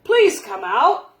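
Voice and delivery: loud, slow call from outside. A narrator's reading. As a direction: call to someone indoors. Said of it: "Bear called".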